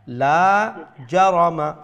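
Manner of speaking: long, loud "লা" in "লা জারামা"; lengthened LA. Shown as a demonstration. Teacher showing the alif madd, "লা" stretched long. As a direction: hold the vowel of "লা" before "জারামা". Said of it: "একটারে লম্বা করবা".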